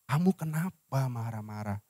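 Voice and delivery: low, soft, gentle question; acted calm partner opening a talk; calm reassurance. Models calm talk to angry partner. (bertanya lembut dan tenang)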